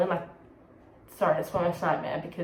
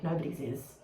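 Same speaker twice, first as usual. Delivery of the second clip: ordinary delivery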